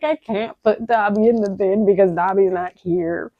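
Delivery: put-on a touch of a childish Dobby-ish protest; lightly voiced stubborn plea; imitating myself. Traveller refusing to put Dobby in the bin, lightly voiced plea. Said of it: "I was like".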